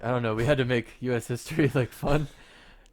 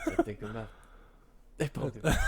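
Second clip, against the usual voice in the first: Godfather quote; 3 dB quieter, faster than usual. Retold or imitated. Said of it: "the Godfather".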